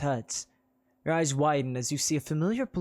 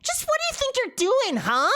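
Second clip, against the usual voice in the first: very high, loud confrontation; harpy confronting the preacher; shrill harpy voice; 6 dB louder than usual. Acted. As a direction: squawk at him accusingly. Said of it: "A shrill voice".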